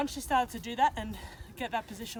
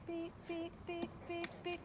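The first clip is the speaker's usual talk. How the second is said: high, quiet beeping cue to the dog; 7 semitones higher, 9 dB quieter than usual. Shown as a demonstration. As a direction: chirp the beeps softly and high, like a signal. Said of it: "when I beep, she backs up".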